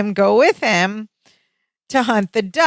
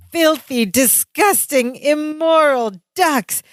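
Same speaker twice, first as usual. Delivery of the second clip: higher, slow mock disgust; outraged Balky; 6 semitones higher, slower than usual. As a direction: spit out each word, imitating Balky. Quoting Balky's rant again.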